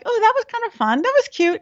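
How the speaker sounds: high, chirpy mock viewer reaction; lightly mocking high voice; imitating a pleased viewer. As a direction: pitch up brightly like an impressed fan. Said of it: "and like".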